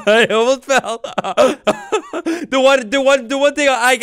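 laughing after almost falling off a ledge; breathless giggles through the words. Real, not acted. Laughing at himself after nearly falling off the mountain.

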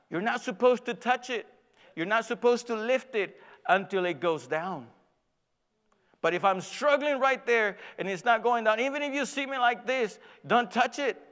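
mad son. Retold or imitated. Son telling dad not to touch the bar, exasperated. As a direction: lay down the rule, imitating an annoyed son. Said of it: "he got so mad".

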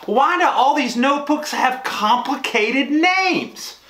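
high, drawn-out exasperated complaint; comic exasperation. Real, not acted. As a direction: throw up your hands at impossible names, pitched high and slow. Exasperated after fumbling brand names.

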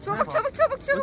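high, rushed urging; urgent hurrying. Real, not acted. Rushing kid to eat. (acele ettiren heyecanlı ses)

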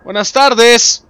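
high, loud, long-drawn greeting; hollered greeting. Pretend. Player greeting someone in the game, drawn-out holler. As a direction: call it out as if across a room.

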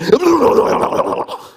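loud, high spluttering gibberish noise; wild rolling cartoon-beast babble; Tasmanian devil. Preacher giving Tamar's answer to Judah, Tasmanian devil splutter. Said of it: "That's what the Tasmanian devil says".

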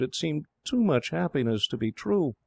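put-on high, tearful girl's voice; lightly acted sob, pitched high; a touch of tearful disbelief; acted tearful sobbing. A narrator's reading. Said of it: "she sobbed".

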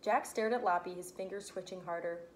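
ordinary delivery, nothing marked

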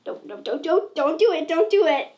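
begging yourself not to cry, rising to loud; building plea. Retold or imitated. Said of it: "it's like".

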